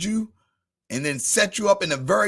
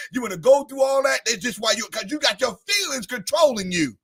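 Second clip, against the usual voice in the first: sung-out sermon cadence rising higher; preaching; 6 semitones higher, 4 dB louder than usual. Acted. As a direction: ride the rhythm of the sermon and build. Preaching building with music.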